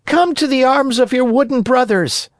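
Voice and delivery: high, eager calling; shout. A narrator's reading. Harlequin invites Pinocchio to his brothers, shouting. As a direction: call out high to a long-lost brother. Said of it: "shouted Harlequin".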